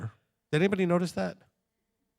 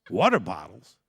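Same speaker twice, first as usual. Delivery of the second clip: mock disbelief; 9 semitones lower, 3 dB louder than usual.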